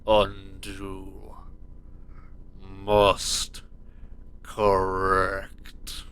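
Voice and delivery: low, possessed demon chant; creepy demon voice. Acted. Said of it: "in a creepy, possessed demon voice".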